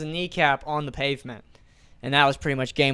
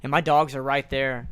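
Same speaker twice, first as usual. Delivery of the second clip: ordinary talk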